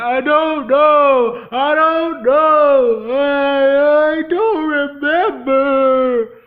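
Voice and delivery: high, loud, very slow sobbing; breaking down crying. Retold or imitated. Matt crying to Shelby that he doesn't remember, slow wailing sob. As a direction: blubber the words out through tears. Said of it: "Matt broke down crying".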